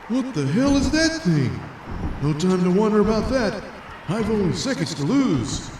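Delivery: high, urgent superhero voice; a jumpy hero racing the clock; superhero Flea. Acted. The Flea spotting the sea monster, high urgent hero voice.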